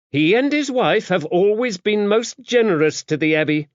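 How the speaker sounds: high, firm abbess voice with a stern edge; stern, defending the benefactors. A narrator's reading. Dame Tabitha rebuking Max for doubting the Gories, stern. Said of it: "she added sternly".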